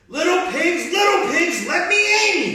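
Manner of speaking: high, loud wolf demand; wolf growl. Acted. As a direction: bellow at the door. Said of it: "he growled".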